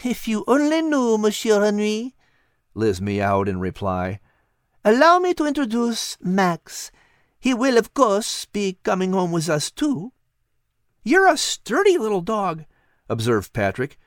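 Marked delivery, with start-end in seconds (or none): 0.0-10.1 put-on high, lilting French-accented cat voice; a wise cat coaxing gently; cat Liz. Cat Liz coaxing Patrick in a high French-tinged voice. 11.0-12.7 put-on high, eager boy voice; a curious boy talking to a cat; young boy Patrick. Boy Patrick wondering aloud to the cat, high and eager.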